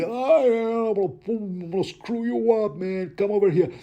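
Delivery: high-pitched mock brute, taunting; squeaky mock threat; wildling brawler. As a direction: play a hammed-up reckless fighter. Mocking wildling fighter in quote.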